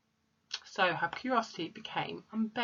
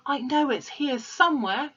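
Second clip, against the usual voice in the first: higher-pitched searching remark; acted muttering to herself; 7 semitones higher, 4 dB louder than usual. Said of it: "she muttered to herself".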